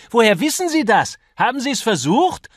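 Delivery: very high, loud indignation; furious outburst. A narrator's reading. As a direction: rail at your opponent in outrage. Acted quarrel, furious challenge.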